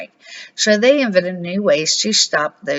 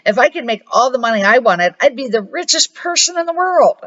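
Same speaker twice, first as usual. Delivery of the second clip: put-on rising higher with boyish glee; excited shout; 6 semitones higher than usual. A narrator's reading. Boy imagining endless money, excited higher voice. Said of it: "shouted Ethan".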